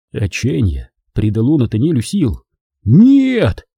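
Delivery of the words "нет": high, loud desperate roar; young man roars back at the robber, loud. Acted. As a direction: bellow it with all remaining strength. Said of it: "проревел он".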